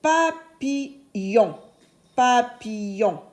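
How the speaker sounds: papillon slowly, syllable by syllable; sounded-out word. Shown as a demonstration. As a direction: pull "Papillon" apart into its syllables. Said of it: "j'entends le son yon". (papillon lentement, syllabe par syllabe)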